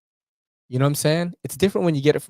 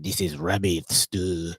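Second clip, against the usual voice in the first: put-on French accent; 4 semitones lower, slower than usual. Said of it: "The guy was mad French".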